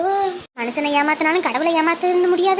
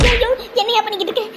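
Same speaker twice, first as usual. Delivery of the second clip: panicked cry; 6 semitones higher, faster than usual. Acted. Doctor taps her knee.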